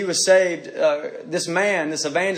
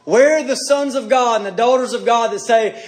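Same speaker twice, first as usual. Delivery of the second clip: ordinary delivery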